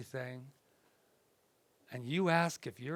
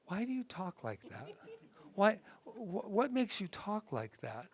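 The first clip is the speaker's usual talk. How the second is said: lightly voiced mother, higher and softer; mildly baffled mother's questioning; retold mother; 5 semitones higher, 4 dB quieter than usual. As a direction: imitate a puzzled mom asking softly. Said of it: "And she would go".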